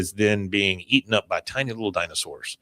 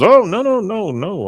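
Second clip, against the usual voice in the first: hasty high-pitched denial; Hank; 11 semitones higher, 5 dB louder than usual. Acted. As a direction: protest too much after being caught out. Hank caught out, denying to wife.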